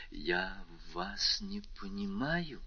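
very slow, whispered reply; quiet. A narrator's reading. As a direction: barely get the words out, almost whispering. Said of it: "тихо сказала она".

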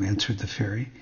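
ordinary delivery

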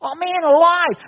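loud, high outburst of joy; overjoyed cry. Retold or imitated. Adam overjoyed at Eve, loud high cry. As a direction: jump out of your skin. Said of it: "this guy's jumping out of his skin".

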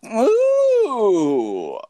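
high squealing ooh; excited ooh. Acted. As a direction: gasp with delight. Mock excited reaction to question.

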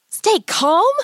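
high-pitched disbelieving question; incredulous hiss. Acted. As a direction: throw it back, appalled. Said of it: "Hansel hissed".

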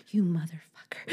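unvoiced whisper; whispered kid swear. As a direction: breathe it like a little kid. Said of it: "'cause they would say".

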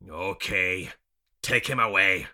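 loud, firm order; forceful captor's order; commanding. A narrator's reading. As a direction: command your men at full voice. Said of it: "the other man commands".

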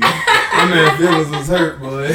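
loud, slow laughing. Real, not acted. Telling friends the driver was hurt, laughing. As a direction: laugh it out slowly.

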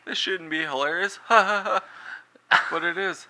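high, laughing through the quote. Real, not acted. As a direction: giggle out the thought. Laughing while retelling thought.

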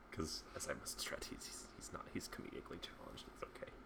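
a sly whispered jab behind his back; whispered aside. Real, not acted. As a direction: whisper it, half teasing. Whispered aside about a friend.

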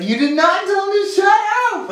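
high, shrieking mock disbelief; friend's shocked squeal. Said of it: "he just died laughing".

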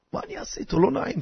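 hushed, half-whispered worry; embarrassed aside. Acted. Host unsure what to do with the guest, hushed aside. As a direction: mutter it under your breath as an embarrassed host.

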